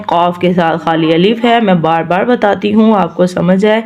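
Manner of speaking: ordinary talk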